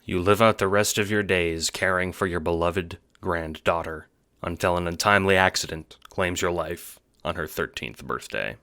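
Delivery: usual speaking voice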